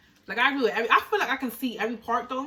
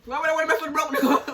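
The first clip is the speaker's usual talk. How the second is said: high, louder disbelieving question; incredulous; 6 semitones higher, 4 dB louder than usual. Real, not acted. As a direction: scoff at the idea. Incredulous after laugh.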